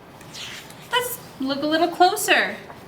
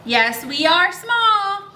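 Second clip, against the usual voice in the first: put-on reading to children; 4 semitones higher, slower than usual. A narrator's reading. Reads book lines to kids.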